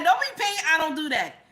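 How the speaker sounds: high, quick, offended protest; mock indignation. Mimicking someone refusing a stranger's payment, mock indignant. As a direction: act affronted by a kind offer.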